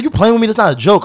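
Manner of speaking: hoger en luider, geïrriteerde vader; boze vader. Retold or imitated. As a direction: snauw als een boze vader aan de telefoon. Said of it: "he's tight".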